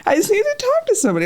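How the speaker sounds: high, airy sweet-lady voice; mock sweet woman. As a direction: parody her sudden sweetness. Calmed woman quoted.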